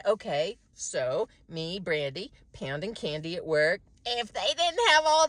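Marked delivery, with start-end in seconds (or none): none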